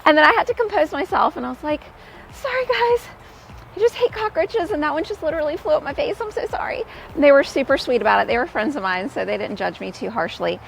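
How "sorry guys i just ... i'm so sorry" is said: sheepish apology. Retold or imitated. Apologizing to clients after screaming.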